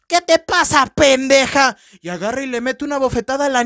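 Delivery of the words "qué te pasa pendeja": mock angry aunt. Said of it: "entra su tía y le dice".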